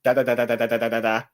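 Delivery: fast, rattling da-da-da burst; quick mock sound effect from the cloak; imitated rapid sound effect. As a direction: rattle off the noise like a machine going off. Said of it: "this sort of".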